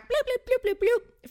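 high, silly tape-rewind bloops; rewind noise. Pretend. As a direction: make a cartoon rewind sound. Rewinding the story, backtrack.

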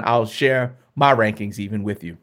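ordinary delivery

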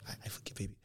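unvoiced hush, I forgive; whispered forgiveness. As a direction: breathe it out tenderly.

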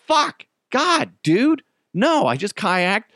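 high, whiny mock outburst; exasperated protest. Acted protest at being refused.

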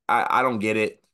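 ordinary talk, nothing marked

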